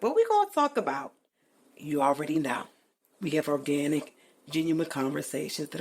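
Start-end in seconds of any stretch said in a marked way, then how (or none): 0.0-1.1 high, loud mimic of eager fans; lightly voiced eager listener asking; mock curious people. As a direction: toss it up like a nosy friend. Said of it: "people always like".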